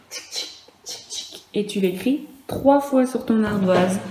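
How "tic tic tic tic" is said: whispered tic tic like a camera shutter; camera click whisper. Shown as a demonstration. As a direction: click softly like taking a photo. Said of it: "on le prend en photo".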